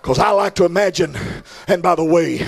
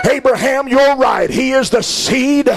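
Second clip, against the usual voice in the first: full-force preaching; 8 semitones higher, slower than usual. Acted. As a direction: preach it up to a rousing climax. Preacher builds to climax.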